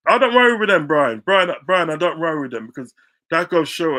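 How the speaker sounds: loud and high, calling out over the noise; loud exclaiming. Real, not acted. Telling Brian to ignore Spurs fans, loud and dismissive. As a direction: shout it across to Brian, dismissive.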